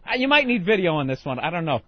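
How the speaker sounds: ordinary talk, nothing marked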